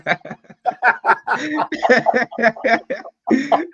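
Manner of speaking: loud, high-pitched cackle; a real, loud shriek of laughter; high burst of laughter. Real, not acted. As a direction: break into a big high laugh. Big laugh at Cav's quote.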